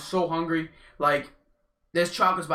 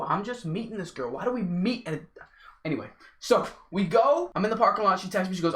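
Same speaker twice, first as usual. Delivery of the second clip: usual speaking voice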